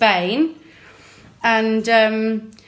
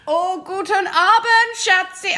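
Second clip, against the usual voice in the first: put-on higher, slow German-accented shopkeeper greeting; 9 semitones higher, slower than usual. Shopkeeper greeting a customer in German, German accent. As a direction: sing out a sweet German hello. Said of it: "I can't even do it now. I sound very German".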